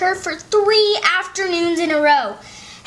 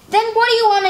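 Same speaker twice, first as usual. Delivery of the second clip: quick, higher, exasperated question; exasperated brother; 7 semitones higher, 4 dB louder, faster than usual. Acted. Henry asks his sister what she wants, fast and exasperated. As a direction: fire it off, losing patience. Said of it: "Henry asked".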